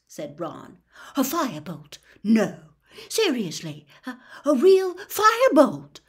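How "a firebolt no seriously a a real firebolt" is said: building to a much higher, louder cry; can't believe his luck, voice soaring; thrilled disbelief. Acted. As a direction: Wood overwhelmed by the news of a firebolt. Wood hears of the firebolt.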